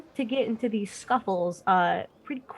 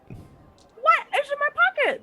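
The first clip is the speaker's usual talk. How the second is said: sudden high-pitched outrage; shocked disbelief; slower than usual. Real, not acted. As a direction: yelp it in shock. Player learns his gem is gone.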